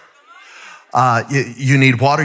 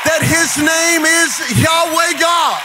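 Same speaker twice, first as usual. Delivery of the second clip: preaching at full force; 6 semitones higher than usual. Acted. Preacher calling the church to praise, building to a shout. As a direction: preach it higher and higher to the crowd.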